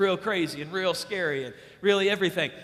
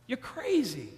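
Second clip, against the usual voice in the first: ordinary talk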